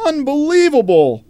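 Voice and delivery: loud exasperated shout. Acted. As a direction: throw up your hands. Exasperated outburst.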